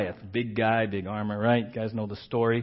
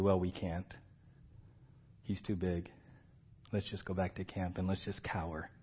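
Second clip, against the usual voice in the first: resigned defeat; 8 semitones lower, 14 dB quieter than usual. Retold or imitated. Defeated inner voice voiced.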